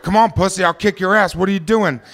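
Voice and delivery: high-pitched, full-volume taunting; screaming bully. Retold or imitated. Bully screaming at a smaller kid as the hockey game looks on. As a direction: yell it like a bully picking a fight. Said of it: "he's just screaming in my face".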